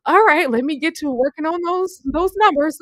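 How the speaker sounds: mock meek Famous. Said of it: "It was just like".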